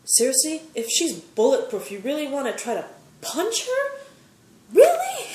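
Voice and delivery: high, rising disbelief; mocking "really?" in disbelief; incredulous. Real, not acted. Mocking the dumb attacker.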